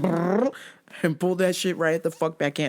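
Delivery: normal talking voice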